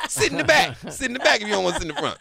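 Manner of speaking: high laughing, fast comeback; laughing retort. Real, not acted. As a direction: laugh it off, then rattle it. Laughing, defending his car.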